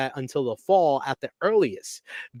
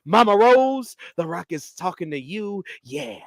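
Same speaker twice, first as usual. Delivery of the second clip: Rock promo impression; 10 semitones higher than usual. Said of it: "he says".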